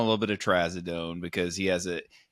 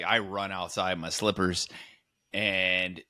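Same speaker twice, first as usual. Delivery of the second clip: ordinary talk, nothing marked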